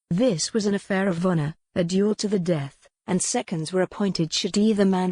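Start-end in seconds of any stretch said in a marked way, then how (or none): none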